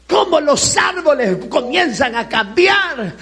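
loud, driving preacher cadence; loud, rousing sermon delivery; fiery preaching. Acted. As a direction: preach it to the congregation full force. Preaching at full volume.

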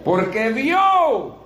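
high, stretched-out proclamation; drawn-out preaching cry. Acted. Preacher telling how the rich man cried out, drawn-out high cry. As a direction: cry it out slowly to the congregation, pitch lifted.

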